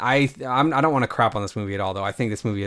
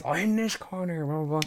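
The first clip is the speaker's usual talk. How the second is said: higher, softer mock ring announcer; quick comic impression, pitched up and quieter; toothless wrestling referee; 6 semitones higher, 6 dB quieter than usual. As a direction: imitate an odd toothless referee announcing a corner. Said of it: "he looked all weird. He's".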